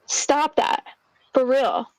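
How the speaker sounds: normal talking voice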